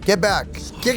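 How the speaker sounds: ordinary talk, nothing marked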